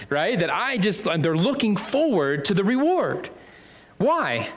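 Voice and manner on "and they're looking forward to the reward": ordinary talk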